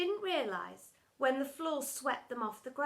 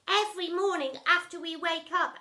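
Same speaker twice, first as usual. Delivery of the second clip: higher gushing lovey-dovey voice; higher soppy father voice; 6 semitones higher, 6 dB louder than usual. A narrator's reading. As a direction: gush like a besotted dad.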